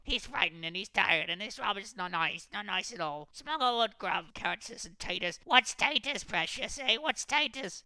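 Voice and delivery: high creature whine; puzzled, sulky Gollum voice; whining creature. A narrator's reading. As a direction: sneer at the question.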